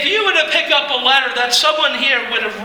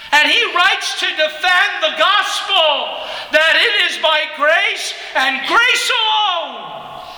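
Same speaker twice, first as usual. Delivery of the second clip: higher and building, slowing to a shout on "grace alone"; fervent preaching; 8 semitones higher than usual. Acted.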